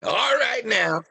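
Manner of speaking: hearty shout over the party; loud call. Acted. Party host shouting to dancing guests, loud. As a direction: call out to the whole room.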